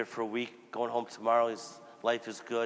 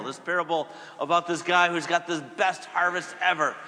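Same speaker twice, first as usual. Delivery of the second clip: ordinary delivery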